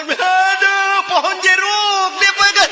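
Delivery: loud, high wail of pain; hammed-up agonised bawling; crying in pain. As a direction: howl as if pricked by thorns. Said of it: "menangis kesakitan karena jeruk tikam".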